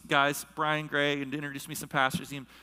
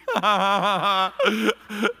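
real, loud, high burst of laughter; 5 semitones higher, 8 dB louder than usual. Real, not acted. Pastor laughing at spending it on books, loud laugh. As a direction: crack up at your own folly. Laughs at his own folly.

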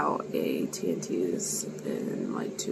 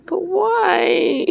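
whiny complaint; 13 semitones higher, 11 dB louder than usual. Real, not acted. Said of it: "I'm kind of a big baby".

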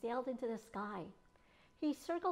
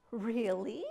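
sly; 5 dB louder than usual. A narrator's reading. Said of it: "with a sly smile".

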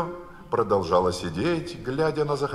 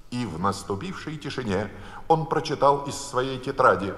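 ordinary delivery

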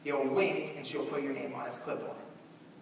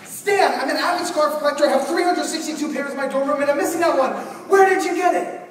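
much higher, louder, fast mock-earnest pitch; mock serious; 11 semitones higher, 12 dB louder than usual. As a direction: play it deadly serious but over the top. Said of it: "with all the seriousness I can".

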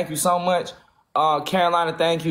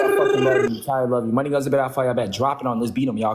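ordinary talk

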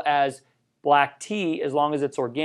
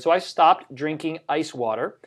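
usual speaking voice

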